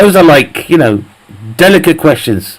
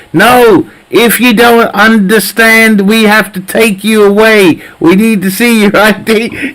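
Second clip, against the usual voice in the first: higher, slow, threatening cop voice; mock stern officer; 7 semitones higher, slower than usual. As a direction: lay down the rules like a bossy officer. Acted police officer threatening citizen.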